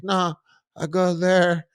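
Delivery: put-on high, slow, spaced-out girl; dazed high voice. Tripping girl tells her friends in a high, slow, dazed voice. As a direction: drift the words out, dazed and high.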